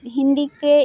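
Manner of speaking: ordinary talk, nothing marked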